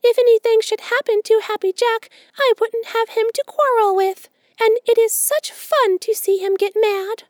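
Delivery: quick squirrel voice. A narrator's reading. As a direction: fire back like a nimble squirrel. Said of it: "he replied quickly".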